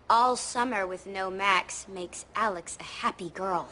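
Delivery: mock celebrity impression. Shown as a demonstration. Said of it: "Oh, I got one".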